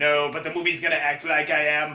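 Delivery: deep, quiet, mechanical drone; low robot voice. Acted. Broken robot answering the kid, deep and quiet. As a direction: drop way down and go soft, like a dying machine.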